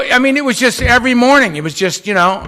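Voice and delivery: ordinary delivery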